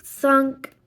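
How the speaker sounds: plain reading voice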